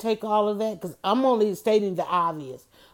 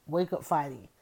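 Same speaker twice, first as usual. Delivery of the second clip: 1 quiet trial of the motto; 6 semitones lower, 8 dB quieter than usual. Shown as a demonstration. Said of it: "change it back to wake up fighting".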